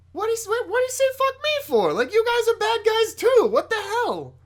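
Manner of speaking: very high, loud wailing protest; mock indignation. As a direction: play a villain's wounded outrage for laughs. Mocking the villain's wounded stance.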